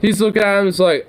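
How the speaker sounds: usual speaking voice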